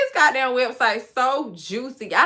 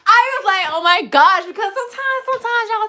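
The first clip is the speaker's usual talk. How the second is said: high, loud, worked up; high excited; 10 semitones higher, 7 dB louder than usual. Real, not acted. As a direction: pitch it way up, overwhelmed. Dramatic retelling, high pitched.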